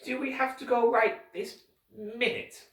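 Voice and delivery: put-on high, worried girl voice; nervous. Violet asking Mister Poe, nervous. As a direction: ask hoping to stay a little longer. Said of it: "she felt very nervous".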